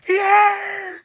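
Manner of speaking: high, loud full-throat yell; battle shout. Acted. Hero yelling a war cry in the brawl. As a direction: roar it into the fray.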